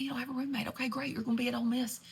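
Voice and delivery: ordinary talk, nothing marked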